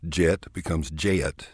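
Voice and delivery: "jet" stretched into two syllables, "je-et"; Texas two-syllable jet. Shown as a demonstration. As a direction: drawl "jet" with a Texas lilt. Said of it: "convert one-syllable words to two-syllable words".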